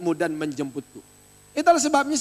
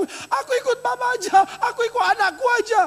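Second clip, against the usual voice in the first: high, fast whiny mock lament; hammed-up grieving child begging to follow the dead; mock mourner wail; 13 semitones higher, faster than usual. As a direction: parody a melodramatic mourner. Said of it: "Terus kau bilang".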